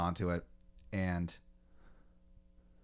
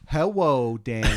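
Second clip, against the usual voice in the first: put-on high, loud little-kid voice; child's voice; 3 semitones higher, 5 dB louder than usual. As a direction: greet like a lisping small child. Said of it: "it has the voice of, like, a child".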